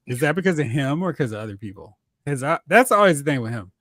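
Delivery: usual speaking voice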